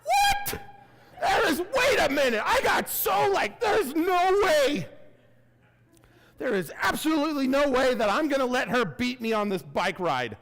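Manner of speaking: high, loud indignant yelling; outraged shout. Retold or imitated. Passed on the hill by an older rider, high loud outraged shout. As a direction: refuse to be beaten, shouting it out.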